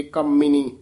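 ਕਾਮਿਨੀ with short first vowel and pressed m; tightened m. Shown as a demonstration. Teacher correcting a pupil, ਕਾਮਿਨੀ with firm double m. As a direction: press the m in ਕਾਮਿਨੀ, like kam-mini. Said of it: "ਥੋੜ੍ਹਾ ਜਿਹਾ ਕੱਸ ਕੇ ਹੀ".

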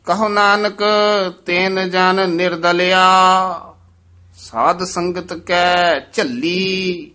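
scripture recitation. Real, not acted. Teacher reads Gurbani to the class, sustained recitation. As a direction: recite phrase by phrase, holding the endings.

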